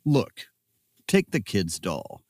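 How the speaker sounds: ordinary talk, nothing marked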